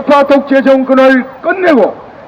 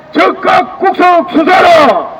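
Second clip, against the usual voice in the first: slow, high shouted demand; roaring rallying speech; 5 semitones higher, slower than usual. Real, not acted. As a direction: drag out the shout for the crowd. Rally chant against government.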